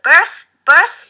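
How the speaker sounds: birth with a clear th at the end; th sound. Shown as a demonstration. Teacher drills the th sound in birth. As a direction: show the th in birth.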